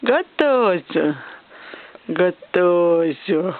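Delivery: slow drawn-out coaxing. Real, not acted. Drawn out to baby.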